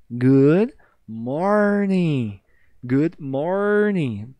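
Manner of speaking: morning with the R dragged, very slow; rolled R. Shown as a demonstration. Teacher modelling the dragged R, slow. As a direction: drag the R in morning. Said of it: "Pode arrastar o R".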